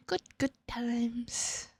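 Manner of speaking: slow whispered good times; sarcastic whisper. Pretend. Streamer recalling dog bite, slow wry whisper. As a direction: whisper it wryly.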